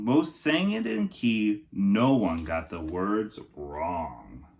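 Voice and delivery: storybook narration for kindergartners; lilting rhyming picture-book voice; reading to children. Acted. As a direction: read it like story time for little kids. Reads Night Before book aloud.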